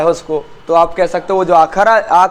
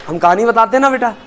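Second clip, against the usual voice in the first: usual speaking voice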